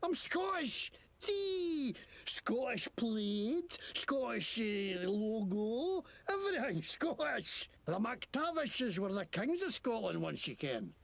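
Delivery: a hammed-up, warped Scottish accent; Scottish brogue learned off a burnt tape; fake Scottish accent. A game character in a fake Scottish accent, answering the player who asks about the accent. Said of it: "What the hell kind of accent is that?".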